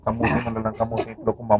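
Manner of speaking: very soft, hushed retelling; whispered aside. Retold or imitated. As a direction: drop to a hush. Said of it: "binulungan ko".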